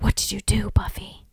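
eerie unvoiced whisper of many voices. A narrator's reading. Narrating the haunting voices in a whisper. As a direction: breathe it like ghostly voices. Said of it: "different voices saying".

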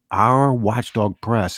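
"our" drawn out and leaned on; stress on OUR. Real, not acted. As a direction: put deliberate weight on the word "our". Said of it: "I always emphasize the word our".

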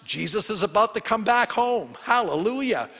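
rousing preaching. Real, not acted. Preacher welcoming Jesus back to our planet, building exultation. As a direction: build to a jubilant cry.

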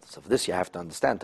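ordinary talk, nothing marked

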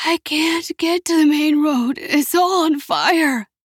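high, strained hoarse croak; desperate mother's hoarse voice. A narrator's reading. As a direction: croak it out in distress, dragging the end. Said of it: "their mother croaked hoarsely".

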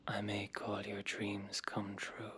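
very soft, low near-whisper; soft, low, seductive murmur; hushed promise. Acted. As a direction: lure them in, low and hushed.

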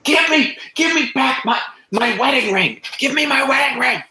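very high, flustered doctor demanding it back; flummoxed stammering. Acted. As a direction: stammer it out high-pitched and frantic. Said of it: "starts stammering".

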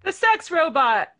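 loud laughing delivery. Real, not acted. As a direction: laugh it out loudly. Laughing joke.

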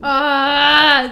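drawn out, high and loud; swooning. Real, not acted. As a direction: gush over it, loud and slow. Swooning over the book.

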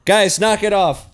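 loud yell to knock it off; shouting. Acted. Yelling at the thruple to stop. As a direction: bark it at the noisy room.

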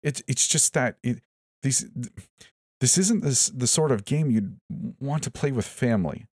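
high, stammering panic; flustered, high-pitched stammer; frantic sputter. A narrator's reading. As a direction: stumble over the words frantically. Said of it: "Jonathan sputtered frantically".

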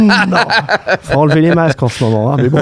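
talking through laughter; laughing. Real, not acted. Joking back to the co-host about masks, laughing. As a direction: laugh while getting the words out.

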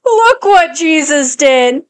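slow, drawn-out movie line; movie quote. Retold or imitated. Mother quoting a line from Ted, slow and drawn out. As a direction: savor each word of the quote. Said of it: "one of the people is like".

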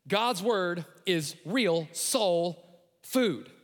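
slow, weighty preaching line; slow, deliberate punchline; slow emphatic. Real, not acted. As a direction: drag it out for emphasis.

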